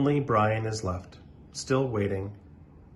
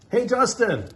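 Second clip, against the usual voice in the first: loud, higher call across the yard; 11 semitones higher, 5 dB louder than usual. Acted. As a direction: shout across the playground. Emilio calls out.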